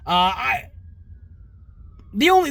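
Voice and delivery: normal talking voice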